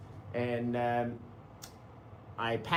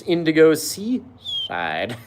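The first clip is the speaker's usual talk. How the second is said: usual speaking voice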